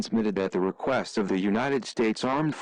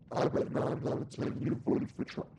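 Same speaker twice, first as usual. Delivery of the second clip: hushed goading; 8 dB quieter than usual. Acted. Rebel goading soldier over radio.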